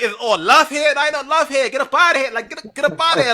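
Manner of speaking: high, hammed-up imitation of the rival; mocking Courtney. Fan mimicking the rival player bragging in Brooklyn, high mock voice. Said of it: "She talking about".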